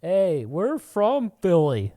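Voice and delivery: mock threatening fan; tough guy. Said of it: "they're just like".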